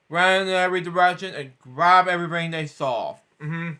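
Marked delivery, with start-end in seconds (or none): none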